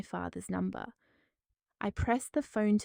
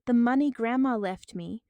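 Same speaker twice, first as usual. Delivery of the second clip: louder shout; 3 semitones higher, 7 dB louder than usual. A narrator's reading. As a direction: yell it in outrage. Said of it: "I shouted".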